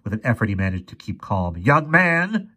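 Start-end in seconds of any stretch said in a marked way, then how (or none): 1.6-2.5 high, strained address. A narrator's reading. Professor addresses the armed boy, strained. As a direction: hold down anger, pitch raised. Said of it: "said in a strained voice".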